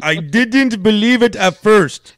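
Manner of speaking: half-hearted Russian accent that slips toward Indian; attempted Russian accent. Shown as a demonstration. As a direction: try a Russian accent and miss. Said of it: "do you know how to do a Russian accent".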